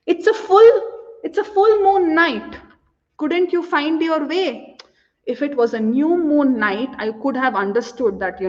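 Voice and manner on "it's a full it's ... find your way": high, taunting reproach; reproachful. Acted. Woman reproaches her lover, high and taunting. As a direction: needle him with the obvious. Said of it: "then she says".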